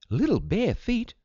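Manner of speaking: high-pitched shocked question; gasped. A narrator's reading. Mother hears of bare footprints in the woods, gasping in surprise. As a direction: echo it back high in astonishment. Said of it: "gasped Mother".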